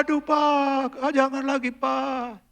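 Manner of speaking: put-on high, drawn-out wail of a spanked child; crying plea. Preacher imitating his crying son begging him to stop, a high wail. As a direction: plead through tears, voice up high. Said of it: "nangis, teriak".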